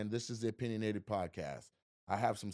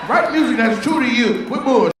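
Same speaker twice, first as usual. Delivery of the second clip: mock yell; 11 semitones higher, 17 dB louder than usual. Yelling advice at rapper.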